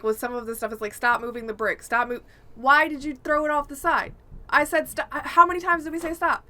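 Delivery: usual speaking voice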